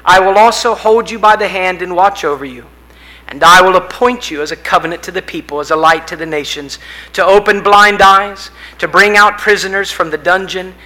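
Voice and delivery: loud, solemn proclamation of God's words; proclaiming scripture. Acted. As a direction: declare it like the voice of the Lord. Reading God's speech aloud.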